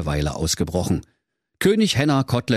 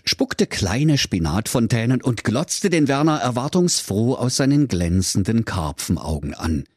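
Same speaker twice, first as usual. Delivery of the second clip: ordinary delivery, nothing marked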